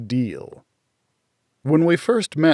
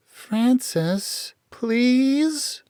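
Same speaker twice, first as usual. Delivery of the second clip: much higher, sugary pleading with a pout; pouting sweetness; 12 semitones higher than usual. Acted. Little sister begs her big sister, all pout and sweetness. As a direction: wheedle big sister into letting her play. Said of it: "all pout and sweetness".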